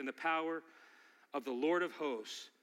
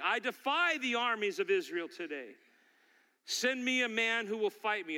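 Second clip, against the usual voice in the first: mildly raised challenger's call; crying out; 6 semitones higher than usual. Acted. Giant defying the armies of Israel, mildly crying out. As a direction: declare defiance to an opposing army. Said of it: "he cries out to the people".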